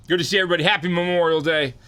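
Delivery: loud, high, pumped-up hello; hyped streamer greeting. Real, not acted. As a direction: greet the chat with big energy.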